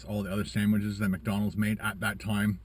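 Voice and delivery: normal talking voice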